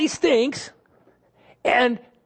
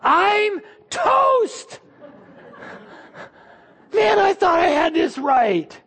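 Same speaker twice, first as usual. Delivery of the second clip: much higher, half-crying mock yell; panicked dread; 9 semitones higher, much slower than usual. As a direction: wail like a man who's sure he's doomed. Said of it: "No, he's thinking".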